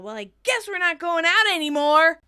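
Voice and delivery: high, loud angry declaration; yelling. Acted. Tommy to Rachel mid-tirade, angry yell. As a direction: shout it as a bitter parting shot. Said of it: "Tommy, still yelling".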